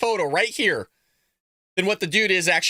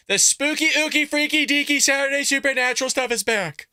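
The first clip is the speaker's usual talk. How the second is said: excited catchphrase; 7 semitones higher than usual. Acted. Show's catchphrase intro.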